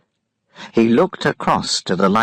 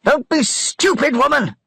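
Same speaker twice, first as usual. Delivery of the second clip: high angry shout at her; furious high yell. A narrator's reading. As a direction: snap at her in fury. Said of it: "he shouted at her".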